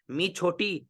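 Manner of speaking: usual speaking voice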